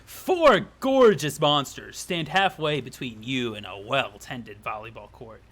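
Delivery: slow, grand introduction, dropping low; theatrical narrator reveal; story narration. A narrator's reading. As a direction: open loud and savour each word. Reads game narration aloud.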